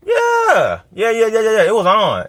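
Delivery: high excited yeahs, pitch jumping way up; hyped yeah. Retold or imitated. Recalling his wild younger days, excited high yeahs. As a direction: pump it up like a young hothead. Reliving his combat phase.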